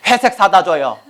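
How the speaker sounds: usual speaking voice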